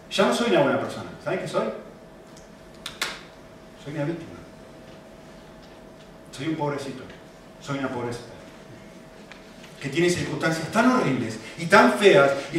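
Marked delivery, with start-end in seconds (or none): 3.8-8.3 soft, low poor-me murmur; hushed woe-is-me; mock self-pity. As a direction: play the little victim quietly. Mocking self-pity of excuse maker.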